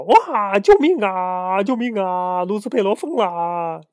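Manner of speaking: high panicked cries for help; panicked screaming. Acted. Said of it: "吓得满屋子跑".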